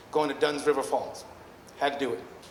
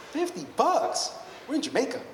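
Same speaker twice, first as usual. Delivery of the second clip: incredulous haggling; 11 semitones higher than usual. Retold or imitated. Tourist balking at the hustler's price, incredulous high-pitched protest. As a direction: balk at the price, pitch shooting up.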